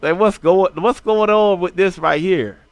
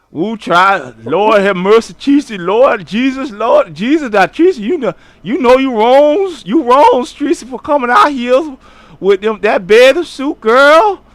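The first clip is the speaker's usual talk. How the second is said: higher, louder, a hint of a scandalized church lady; mock church lady; 6 semitones higher, 6 dB louder than usual. Streamer scolding Tracy like a scandalized church lady. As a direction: call on Jesus over her outfit.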